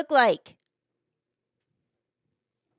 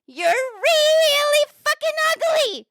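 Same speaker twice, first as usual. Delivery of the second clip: very high drawn-out parrot screech; squawking parrot; slower than usual. Acted. Parrot insulting a passing lady, screeching. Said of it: "He says".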